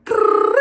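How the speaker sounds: loud, high trilled rattle; mouth-made semi-automatic fire; imitated gun burst. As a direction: roll out a machine-gun noise. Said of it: "He said, was like".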